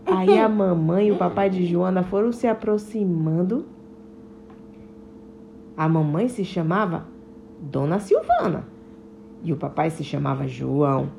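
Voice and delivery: low, calm storyteller voice; story narration. A narrator's reading. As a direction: read it to children, gently.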